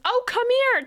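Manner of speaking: high, loud gushing call to a pet; all excited. Retold or imitated. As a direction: imitate an overjoyed cat owner. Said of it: "he's just all excited".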